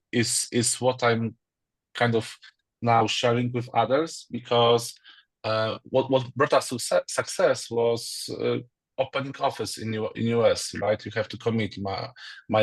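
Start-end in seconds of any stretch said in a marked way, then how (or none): none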